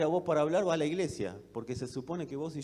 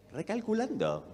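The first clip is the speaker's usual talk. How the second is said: Recalculando in a higher, mechanical sat-nav voice; GPS voice; 6 semitones higher than usual. Shown as a demonstration. Preacher imitating the GPS for the congregation, saying Recalculando robotically. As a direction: sound like the car's navigator saying Recalculando. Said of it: "¿Cómo lo dice el aparatito?".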